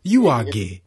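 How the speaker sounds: imitating a flat accusation; loud mock accusation; mock accusing interviewer. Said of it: "was like".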